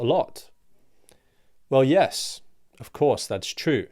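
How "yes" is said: normal talking voice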